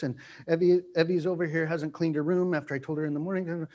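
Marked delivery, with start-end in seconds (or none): none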